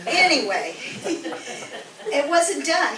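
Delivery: slow words broken by laughter; laughing. Real, not acted. Woman retelling a second sheriff arriving, laughing. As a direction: laugh it out, still mortified.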